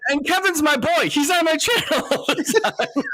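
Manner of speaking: laughing. Real, not acted. Laughing, high-pitched.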